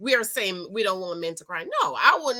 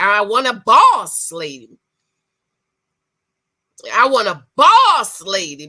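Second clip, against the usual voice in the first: louder, stressing boss; emphatic quote; 11 dB louder than usual. Retold or imitated. Quoting him asking for a boss lady, loud emphatic. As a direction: lean hard on boss like a demand. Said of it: "he said it".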